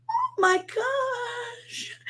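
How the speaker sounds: very high, slow, drawn-out gasp of shock; stunned awe. Retold or imitated. Mom sees her newborn's face, slow stunned high gasp. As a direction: let the shock stretch out. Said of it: "and I was like".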